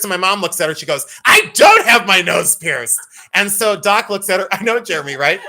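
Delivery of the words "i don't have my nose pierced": slow, loud shouted denial; indignant mom. Retold or imitated. Mom denies the piercing to the doctor, mock indignant shout. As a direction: imitate an outraged mom shouting it out.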